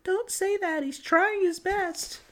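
high, soft, timid squeak; tiny scared voice pleading; meek mouse voice. Retold or imitated. Mouse in the next room pleading for the boy, high and meek. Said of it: "they say".

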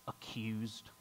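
a hushed whisper for "accused?". Real, not acted. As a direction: breathe the word to the congregation. Sermon, dramatic pause.

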